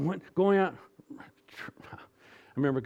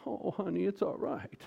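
higher-pitched, soothing mom voice; coddling mommy; 6 semitones higher, 4 dB quieter than usual. Acted. Mommy consoling a child who left football practice, coddling. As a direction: coo it like a comforting mother. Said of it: "Mommy goes".

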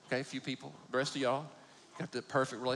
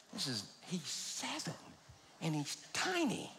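much softer, slow muttered aside; under-breath aside; 2 semitones higher, 10 dB quieter, slower than usual. Retold or imitated. Uncle thinking about the tiny seven-year-old, softly to himself. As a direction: say it to yourself, under your breath. Inner thought, dad can't hear.

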